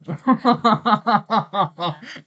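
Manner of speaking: high, loud burst of laughter; long cackling laugh in quick pulses. Real, not acted. Streamer cracking up at his own joke, loud laugh.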